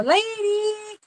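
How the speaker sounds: high, drawn-out call for a dog; imitated calling out. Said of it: "she'd be yelling at the door".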